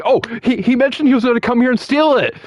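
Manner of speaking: high, put-on tattletale voice; feigned alarm. Eggman lying to Knuckles about Sonic, feigned alarm. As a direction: blurt it out in mock alarm.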